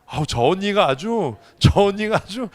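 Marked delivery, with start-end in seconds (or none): none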